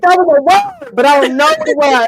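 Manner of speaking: high, loud and puzzled; bewildered high exclaim. Retold or imitated. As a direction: blurt it out, baffled. Quoting herself after dancing.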